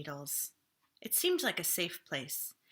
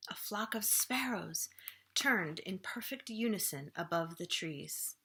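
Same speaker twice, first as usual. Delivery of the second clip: usual speaking voice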